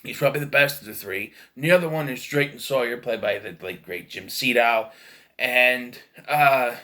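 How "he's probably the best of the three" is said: usual speaking voice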